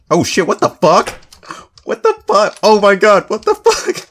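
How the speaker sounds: high shrieking panic, very loud; raging gamer. Real, not acted. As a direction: scream in shock as if ambushed. Jump scare from attacking kangaroo.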